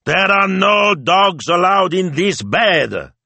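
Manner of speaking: put-on high, loud, drawn-out accented papa; loud papa's proclamation, high and slow; mock-stern cry. A narrator's reading. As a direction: bellow each word slowly in exasperation. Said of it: "he cried".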